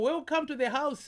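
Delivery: high, bright sing-song welcome; cheery welcome. Acted. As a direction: greet him like a jolly host. Greeting guest with sung welcome.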